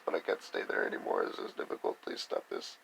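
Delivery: breathy whispered plea; mock whimpering plea; whispered pleading. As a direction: whisper it like a frightened person begging. Mimics people pleading, stop this.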